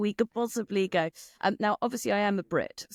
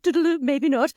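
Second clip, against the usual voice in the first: mock reluctant singsong; 8 semitones higher, 7 dB louder than usual. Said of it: "some people are like".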